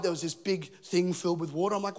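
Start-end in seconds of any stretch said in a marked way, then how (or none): none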